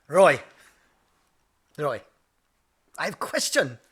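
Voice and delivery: imitated Japanese accent, measured pauses; dad's sage manner in Japanese accent. Dad questions son over dinner, Japanese accent. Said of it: "I have to do it in his accent".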